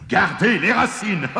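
high, gloating voice breaking into laughter; sneering giant. A narrator's reading. Giant leaves the farmer the roots, sneering. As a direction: crow it with a mocking snicker. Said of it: "ricana le géant".

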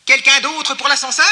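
high, loud call-out; strident shouting. A narrator's reading. Mole calling down the tunnel, strident shouting. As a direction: holler it on a high pitch. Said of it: "cria monsieur Taupe".

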